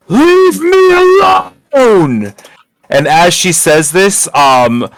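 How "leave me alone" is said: wailing scream. Acted. NPC unleashes sonic scream.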